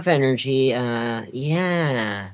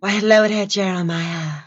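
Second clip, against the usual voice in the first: changed voice; 4 semitones higher than usual. Shown as a demonstration. Showing off voice changes to a friend, shifting. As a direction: switch voices mid-greeting. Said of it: "how many times I changed my voice there".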